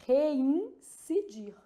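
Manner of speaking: Reincidir very slowly, broken into parts; careful word demonstration. Shown as a demonstration. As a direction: split it as "rein... ci-DIR". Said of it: "Tem esse N aqui".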